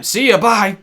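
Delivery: loud, high mock farewell; calling out goodbye. Player bidding Zelda goodbye without her cigarettes, called out loudly. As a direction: toss a big shouted goodbye.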